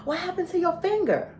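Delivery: high, startled question; a stranger seeing the finger for the first time; shocked question. Retold or imitated. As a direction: ask it in sudden high surprise. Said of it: "they go".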